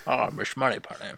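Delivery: slushy Scottish film-star impression; mock suave spy, s turned to sh; Sean Connery. Podcaster playing along with the co-host's teasing, mock film-star impression. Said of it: "is that Sean Connery or Dutch?".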